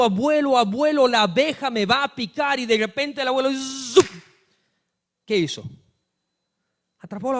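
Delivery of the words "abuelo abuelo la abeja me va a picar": put-on higher, louder panicked little girl; child screaming in fear. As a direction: cry out as the bee circles. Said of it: "Y la niña estaba gritando, gritando". (niña asustada que grita, más aguda y fuerte)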